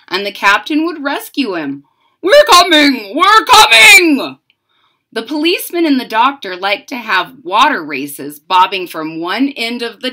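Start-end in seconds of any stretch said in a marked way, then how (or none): 2.2-4.4 high, loud calls on the way; rescuing call. Acted. Father captain calling out to his child, loud and urgent. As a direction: shout it like a sea captain to the rescue.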